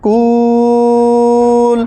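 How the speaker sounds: very slow, sustained "কুল"; three-alif elongation. Shown as a demonstration. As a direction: stretch the vowel of "কুল" before closing on ল. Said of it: "এটা তিন আলিফ টান হয়ে যাবে".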